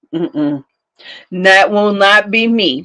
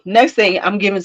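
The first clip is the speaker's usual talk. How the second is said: usual speaking voice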